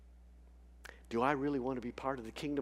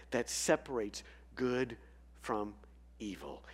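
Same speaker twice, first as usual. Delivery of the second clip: usual speaking voice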